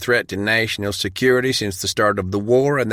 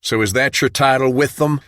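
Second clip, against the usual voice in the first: loud, higher, cutting challenge; sharp question; 6 semitones higher, 4 dB louder than usual. Acted. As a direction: jab at Dale's new title. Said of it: "John asked sharply".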